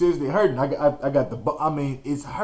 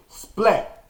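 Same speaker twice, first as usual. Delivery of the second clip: comic splat; 7 semitones higher than usual. Retold or imitated. Sound of landing on the ground.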